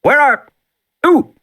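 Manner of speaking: tense voice. Acted. Arnold calling over crackling radio static, tense and urgent. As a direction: sound strained and urgent. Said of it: "then Arnold's tense voice".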